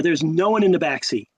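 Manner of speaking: normal talking voice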